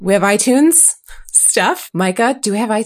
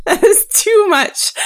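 laughing; 12 semitones higher, 3 dB louder, slower than usual. Real, not acted. Laughing at the long bonus episode.

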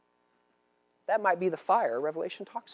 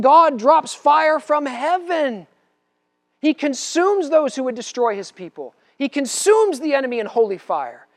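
loud, raised revival preaching; preaching at full force; 6 semitones higher, 5 dB louder than usual. Acted. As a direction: drive the end-time message home. Preaching about end times.

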